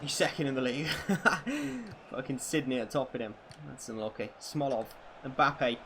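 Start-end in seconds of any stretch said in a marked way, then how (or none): 0.9-2.0 a high amused laugh; short laugh. Real, not acted. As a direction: laugh at the absurdity. Laughing at league table.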